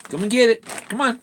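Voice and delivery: calling the dog. Real, not acted. Owner calls dog Jack to come get his treat, high.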